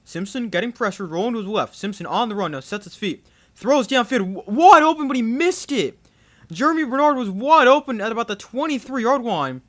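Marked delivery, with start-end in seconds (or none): 3.5-9.6 louder, higher burst at a wide-open miss; excited play-by-play. Real, not acted. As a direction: call the blown chance with rising excitement. Live call, receiver missed wide open.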